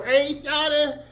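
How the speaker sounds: put-on lampoon a guy calling to a girl, high and sing-song; mock mush-mouth slang. Mocking modern slang he says is new, high sing-song put-on voice.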